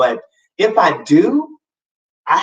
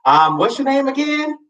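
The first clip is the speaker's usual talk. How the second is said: higher, airy imitation of the contractor lady; imitating the lady; 11 semitones higher than usual. Contractor lady answering the phone, lightly higher imitation. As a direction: mimic her casual brightness. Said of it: "She said".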